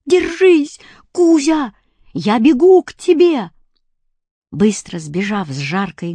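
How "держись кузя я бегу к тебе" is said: calling out. A narrator's reading. Boy calling to cat.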